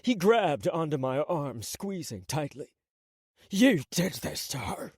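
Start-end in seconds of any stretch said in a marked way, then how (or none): 3.5-4.9 sneer. Acted. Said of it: "He sneered at me".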